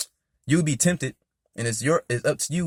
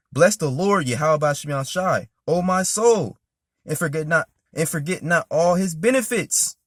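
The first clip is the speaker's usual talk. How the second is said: louder, higher preaching read of the verse; fervent psalm reading; 4 semitones higher, 4 dB louder than usual. Acted. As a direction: proclaim it to the congregation. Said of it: "I'm gonna read verse two again".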